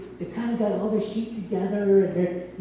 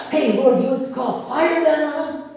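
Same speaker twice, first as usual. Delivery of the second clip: raised, louder and higher, itching to call down fire; eager zealous disciples; 6 semitones higher, 7 dB louder than usual. Acted. Disciples asking Jesus to call fire, eager and loud. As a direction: push it up like hotheads begging permission.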